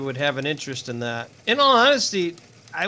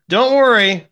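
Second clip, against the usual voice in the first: much louder and higher; loud reassurance; 6 semitones higher, 10 dB louder, slower than usual. Real, not acted. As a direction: belt out a hearty reassurance. Upbeat reassurance after slow work.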